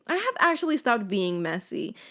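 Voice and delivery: normal talking voice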